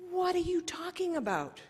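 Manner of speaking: sharp, high-pitched scolding question. Retold or imitated. Tutu scolds Donna over her dignity work, sharply. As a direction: snap at her as if she said something foolish. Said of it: "He's, like, scolding me".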